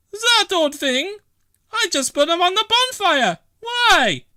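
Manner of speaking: a bustling Italian landlady; Bella's voice. Acted. Bella clearing junk for her new bathroom, brisk.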